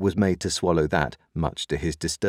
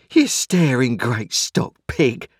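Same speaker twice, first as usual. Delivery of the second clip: high, loud, drawn-out insult; exasperated insult; 7 semitones higher, 5 dB louder, slower than usual. A narrator's reading. Sister abusing Joe after pouncing on him, exasperated. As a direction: hurl it slowly and loudly, pitched up. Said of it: "said my sister, out of breath".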